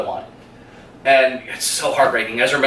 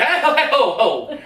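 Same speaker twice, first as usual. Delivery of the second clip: much higher, laughing hey ho; Kowalski's protest; 11 semitones higher than usual. Retold or imitated. Kowalski laughing as Tina tries to leave him, high protest. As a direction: laugh through a mock protest. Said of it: "Kowalski's like".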